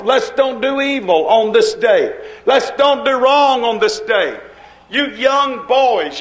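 normal talking voice

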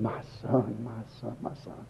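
low, fading to a hush; tender father. Retold or imitated. Father holding his prodigal son, tender and fading.

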